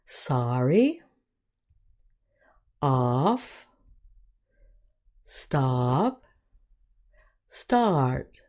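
sorry, off, stop, start with an "ah" vowel; short O examples. Shown as a demonstration. Teacher giving words with short O, careful modelling. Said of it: "The short sound is".

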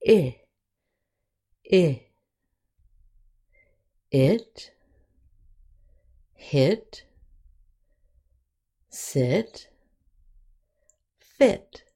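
I said as "ih", then It, hit, sit, fit; short I. Shown as a demonstration. Teacher modelling the short I sound, careful pronunciation. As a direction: model I as a short "ih", each word separate. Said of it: "the short I sound is".

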